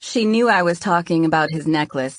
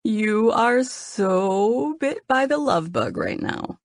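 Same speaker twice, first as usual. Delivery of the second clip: teasing; 5 semitones higher, much slower than usual. A narrator's reading. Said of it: "with a teasing grin".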